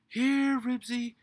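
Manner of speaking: high, loud frantic dog call. A narrator's reading. Henry calling Ribsy away from the meat, frantic shout. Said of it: "called Henry frantically".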